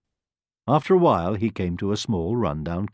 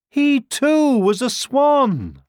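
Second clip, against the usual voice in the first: triumphant reveal; 6 dB louder, much slower than usual. A narrator's reading. Story climax reveal.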